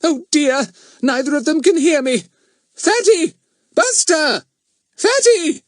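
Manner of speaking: very high, loud motherly shouting; exasperated calling. Acted. Mother calls to boy and dog who cannot hear, exasperated.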